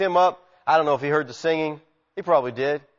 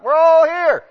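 high, loud reassuring shout; Paul yelling to the jailer; yelled out; 13 semitones higher, 10 dB louder, slower than usual. Acted. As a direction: call out to the whole prison. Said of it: "So Paul just yells out".

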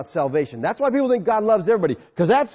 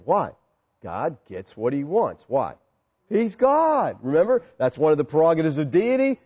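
normal talking voice

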